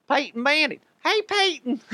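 Brandon Stokley Cajun voice. Retold or imitated. Host imitating Stokley greeting Peyton in a high Cajun voice. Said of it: "I haven't done the Stokley voice in forever".

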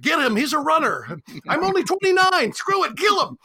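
high, loud mock mob yell; a mob hunting a runner, shouted high; mob shouting. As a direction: bellow it like a bloodthirsty crowd. Logan's Run mob hunting a runner.

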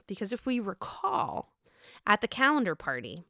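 ordinary talk, nothing marked

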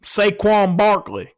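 demonstrated Southern accent. Shown as a demonstration. Host correcting the co-host's impersonation, exaggerated Southern accent. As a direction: show a Southern accent with stretched-out syllables. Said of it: "no, you needed to go".

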